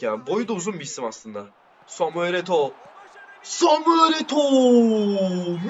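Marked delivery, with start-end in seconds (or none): 3.4-5.7 very slow, high, loud stretched name; goal call. Real, not acted. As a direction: draw the name out like a stadium announcer. (gol anonsu gibi uzatarak)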